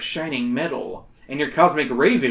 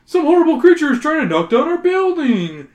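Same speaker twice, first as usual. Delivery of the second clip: much higher, urgent alarmed voice; panicked report; 12 semitones higher, 6 dB louder than usual. Acted. Workman urgently alerting the hero to the monster. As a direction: gasp out the news.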